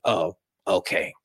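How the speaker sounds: slow, soft, knowing oh okay; knowing understanding. Retold or imitated. Aunt quietly realizing her niece got her period, knowing and slow. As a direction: say it like you finally get it, gently. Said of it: "like she understands".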